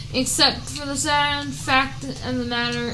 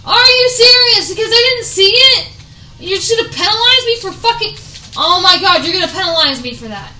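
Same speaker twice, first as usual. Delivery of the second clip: higher, louder indignant rant, fast at the end; outraged; 6 semitones higher, 8 dB louder, faster than usual. Real, not acted. Gamer outraged at the game's penalty, indignant. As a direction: protest the unfair penalty.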